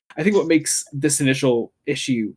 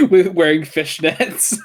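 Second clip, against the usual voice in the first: laughing; 4 semitones higher, 4 dB louder, slower than usual. Real, not acted. Amused at Jean in fishnets.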